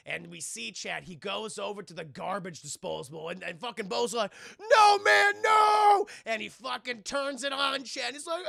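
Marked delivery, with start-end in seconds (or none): 4.6-6.1 very loud, high pleading shout; panicked mock yell. Bo at the garbage disposal, panicked yell. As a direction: yell desperately to stop him.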